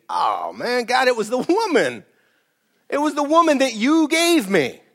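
high, pleading excuses with a changed voice; Adam blaming the woman to God, high and whiny. Retold or imitated. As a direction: whine like a caught kid blaming someone. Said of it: "Remember when Adam was called".

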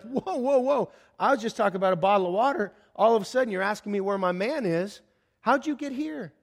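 high-pitched, startled objection; taken aback. Acted. Woman at the well objecting to being questioned, taken aback.

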